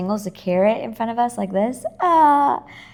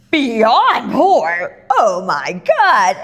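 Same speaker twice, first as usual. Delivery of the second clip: loud, drawn-out mock astonishment; hammed-up disbelief with big swooping pitch; mocking joke; 6 dB louder, much slower than usual. Imitating him joking about her poor childhood, mocking. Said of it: "he's joking with her".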